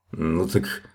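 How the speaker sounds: low, soft mumble; dropped, indistinct little grumble; mumbled. A narrator's reading. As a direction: mutter it under your breath. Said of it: "пробубнил он".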